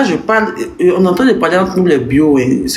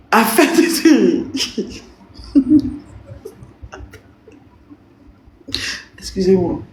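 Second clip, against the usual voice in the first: laughing; 4 semitones higher, 4 dB quieter, slower than usual. Real, not acted. Breaks into laughter.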